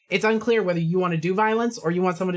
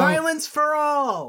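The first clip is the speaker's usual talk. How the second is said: rallying shout; 8 semitones higher, much slower than usual. Pretend. Mock rallying cry for violence.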